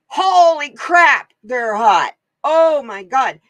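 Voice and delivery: a real yelp of remembered heat; burning-hot exclamation. Real, not acted. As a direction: cry out at the heat of the chili. Recalling the burning chili juice.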